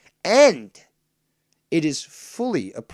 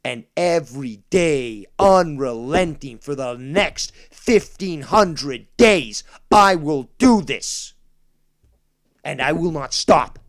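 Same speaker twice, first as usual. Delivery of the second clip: louder, slow deliberate vow of relentlessness; 10 dB louder, slower than usual. Real, not acted. Vowing to keep reminding doubters, slow loud threat. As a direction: pound each phrase like a promise.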